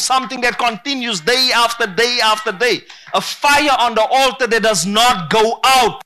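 loud, rising preacher's climax; preaching at full force. Acted. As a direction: build it up and hammer each line to the church.

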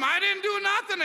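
a hint of a whiny teenage kid; protesting kid. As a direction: plead innocence like a teenager. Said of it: "he's telling him".

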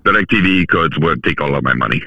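put-on Russian accent. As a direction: play it as a Russian speaker. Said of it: "I didn't know you could do Russian accents".

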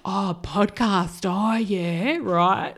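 slow, humouring doctor impression; imagined sceptical psychiatrist. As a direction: draw it out as if humouring a deluded patient. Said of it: "I can see him be like".

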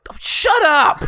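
high, loud yell; hammed-up group outburst; mock shout. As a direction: shout the kid down. Acted crowd silencing a kid.

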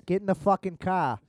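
put-on loud, rough Boston accent. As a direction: bark it like a Boston townie. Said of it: "I can't do a Boston accent".

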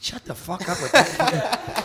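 high, loud, slow mock yell through a laugh; imitating his woman. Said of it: "And she goes".

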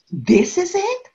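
high, loud amazed disbelief; as if the answer were too simple to be true; stunned client voice; incredulous surprise. Retold or imitated. Withdrawer amazed in session.

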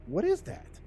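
acted bafflement, pitch jumping up; baffled detective. Detective questions suspect about his wound, baffled.

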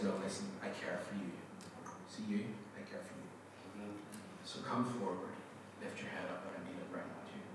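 low, very soft, tender voice; gentle reassurance. Retold or imitated. The Lord calling a shy man forward, gently reassuring. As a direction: quietly coax someone forward with care.

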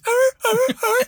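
hammed-up dog barking; big dog bark. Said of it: "And then it's like".

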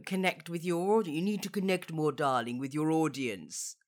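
luvvie director. Retold or imitated. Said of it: "producers and directors might say".

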